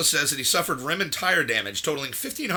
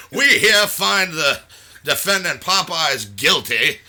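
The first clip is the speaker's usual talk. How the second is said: mock judge; 2 semitones higher, 9 dB louder, slower than usual. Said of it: "It's like".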